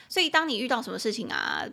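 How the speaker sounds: normal talking voice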